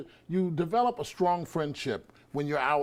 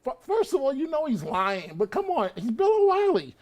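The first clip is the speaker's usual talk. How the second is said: incredulous scoff; 8 semitones higher, 4 dB louder than usual. Real, not acted. Panelist mocking O'Reilly's credibility to the host, incredulous and much higher. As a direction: voice jumping way up and louder on the name.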